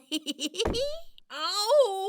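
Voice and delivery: high drawn-out groan of pain. Acted. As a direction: moan like something just got hurt. Pained voice from under logs.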